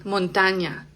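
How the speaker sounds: ordinary talk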